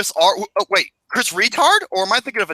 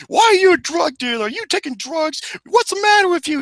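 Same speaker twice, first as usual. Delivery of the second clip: high-pitched scolding of a relative; hammed-up high, nagging rant; mock yelling; 10 semitones higher than usual. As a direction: imitate an outraged mother telling him off. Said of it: "like, yelling at him".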